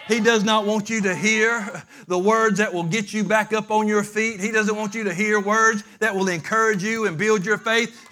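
loud, driving preacher's cadence; loud revival preaching; full-force preaching. Acted. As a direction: preach it hard to the congregation.

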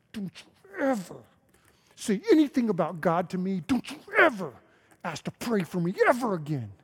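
imitating a tense, angry man, strained hard on each "ever"; retold angry warning. Jeff warns Ken off praying for him, retold angry warning. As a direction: act out his warning with pitch jumping on the repeat. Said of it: "Jeff clenched his fist, his neck bowed".

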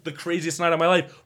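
normal talking voice

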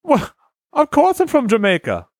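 a hammed-up Jamaican voice on request; Jamaican accent. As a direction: friends ask him for a Jamaican accent.

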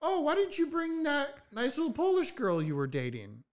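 high, sweet-talking mom voice; imitated mom. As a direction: pitch it up as an inquiring mother. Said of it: "So my mom's like".

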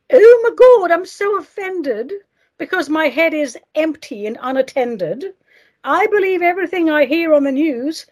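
shrill burst of fake indignation; mock offended outrage. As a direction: squeal it like a scandalized snowflake. Mocking easily offended people.

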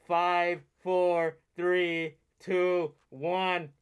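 loud, punched countdown numbers; dramatic countdown. Retold or imitated. Fan replaying the explosion timer, loud countdown. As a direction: call each number like the arena clock.

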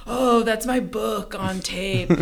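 slow, stretched imitation of a stranger; drawn-out reply. Retelling the guy with the noisy phone, drawn-out mimicry. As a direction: draw it out, mildly mocking.

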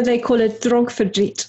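light Afrikaans accent on dronkverdriet. Shown as a demonstration. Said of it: "excuse my Afrikaans accent".

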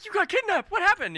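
very high, loud stammered shock; freaking out. Acted. Gate guards believing Colm was dead, freaking out. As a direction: squeal it in startled disbelief. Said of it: "freaking out 'cause they're like".

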